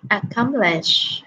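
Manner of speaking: "Accomplish" said very slowly, syllable by syllable; slow model word. Shown as a demonstration. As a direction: drag out "Accomplish" for the class. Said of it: "mình đọc là accomplish".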